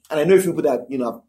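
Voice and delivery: ordinary delivery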